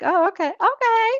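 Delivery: mock meek high-pitched agreement; high, timid giving in; high squeaky okay. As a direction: squeak out a caving yes. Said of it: "and you're like".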